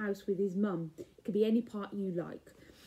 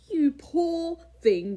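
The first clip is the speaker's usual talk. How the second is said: slow, louder giantess voice; booming giantess; 5 semitones higher, 6 dB louder, slower than usual. A narrator's reading. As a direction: boom it kindly like a giant woman. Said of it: "boomed the giantess".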